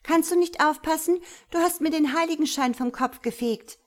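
high, loud angry complaint; indignant yelling. Acted. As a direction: scold the clumsy culprit loudly. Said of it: "schreit Zachariella".

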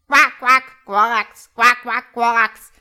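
loud, high croaking chorus of frogs; frogs croaking loudly. A narrator's reading. Crowd of frogs shouting over each other, loud croaking. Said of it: "Eine ganze Menge Frösche schrie".

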